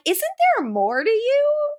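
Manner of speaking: high-pitched mock disbelief; incredulous retort. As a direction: question it in exasperated high pitch. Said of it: "And I'm like".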